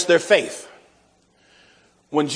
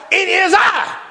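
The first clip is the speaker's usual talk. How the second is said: ghost's reply; 13 semitones higher, slower than usual. Retold or imitated. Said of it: "The ghost replies".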